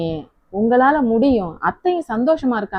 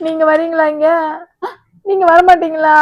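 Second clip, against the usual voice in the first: desperate pleading; 6 semitones higher, 5 dB louder than usual. Acted. Begging husband to come.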